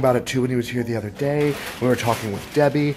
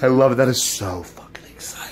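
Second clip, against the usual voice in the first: excited whisper; 3 semitones higher, 7 dB louder than usual. Real, not acted. Overcome with excitement after laughing.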